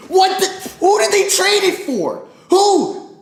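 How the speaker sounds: high, loud shocked outburst; can't believe the trade, yelling; shocked disbelief. Real, not acted.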